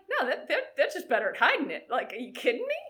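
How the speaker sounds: high, loud laughing dismissal; amused disbelief. Retold or imitated. Coach reassuring a nervous swimmer that others hide it, amused disbelief. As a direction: scoff it away with a laugh.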